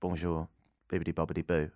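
quiet mock French gibberish. Mock French. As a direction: fake French babble from the police officer. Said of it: "they said in French first".

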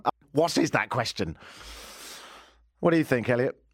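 ordinary talk

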